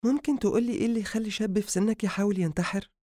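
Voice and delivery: high-pitched woman asking; high, probing female character; female doctor's voice. Acted. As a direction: question him in a high female voice. Doctor questions patient.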